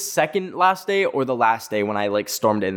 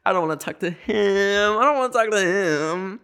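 high, whiny pleading; 6 semitones higher than usual. Retold or imitated. To the assistant, a high whiny plea. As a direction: beg to keep it between you two. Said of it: "and I'm like".

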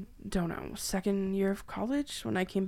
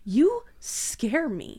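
scared; 5 semitones higher, 5 dB louder, much slower than usual. Acted. Captive confesses fear to captor.

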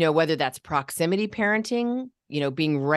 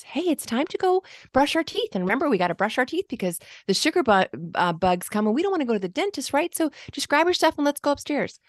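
much higher, fast, chatty anxious parent; high wordy version; 10 semitones higher, faster than usual. Shown as a demonstration. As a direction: pile on the words in a high rushed voice. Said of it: "listen to these two versions".

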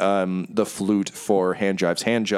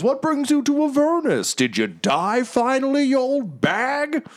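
taunting Magnuson. Acted. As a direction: needle an old companion. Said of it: "Magnuson's like".

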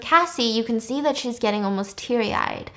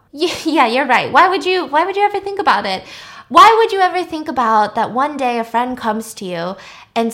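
higher, louder, faster mocking retort; sarcastic bite; 4 semitones higher, 6 dB louder than usual. Cassie mocks Maddie for ignoring a friend. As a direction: throw her words back at her.

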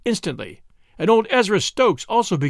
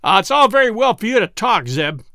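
louder grumbling retort; growled; 7 semitones higher, 7 dB louder than usual. A narrator's reading. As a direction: growl it back at Zeb. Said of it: "growled Zeke".

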